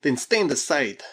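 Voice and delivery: loud, slow command; crying out. A narrator's reading. Krasky orders her aside, a loud cry. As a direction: call the order out firmly. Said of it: "cried Krasky".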